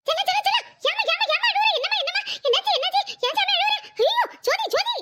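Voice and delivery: high-pitched worried fussing; frantic auntie pleading with a crying child; alarmed. Acted. Aunt alarmed at crying niece.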